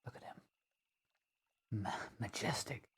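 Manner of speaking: very soft, near-whispered marvelling; hushed awe. Pretend. Watcher marvels at the creature, in a hushed near-whisper. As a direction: breathe it out softly, in wonder.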